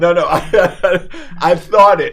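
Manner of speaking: laughing through the words, high and loud. Real, not acted. Guest laughing off his own near-confession to the host, loud and high. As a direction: laugh it off while denying.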